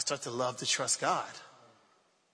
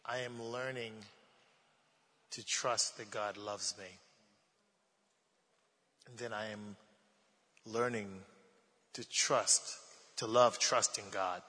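softly sincere; 4 dB quieter than usual. Real, not acted. Pastor closing the sermon softly to the congregation. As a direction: let the words settle gently. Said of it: "let's close with these two thoughts".

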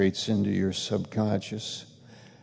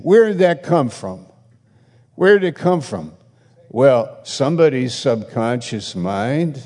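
fiery preaching; 3 semitones higher, 5 dB louder than usual. Acted. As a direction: preach it out with full conviction. Preaching at full force.

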